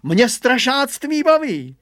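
high, loud, drawn-out boast; boastful. A narrator's reading. Hugo says he enjoys being a scarecrow, boastful. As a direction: crow it like a show-off boy. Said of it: "naparoval se Hugo".

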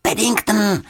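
high-pitched call of a name; urgent high call; called over the shoulder. A narrator's reading. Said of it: "křikla Juditka přes rameno".

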